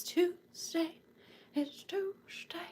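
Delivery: slow breathy whisper; hushed slow whisper. Acted. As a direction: murmur it like a secret. Whispered aside.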